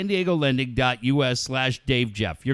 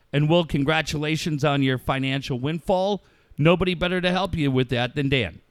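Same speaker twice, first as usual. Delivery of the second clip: ordinary delivery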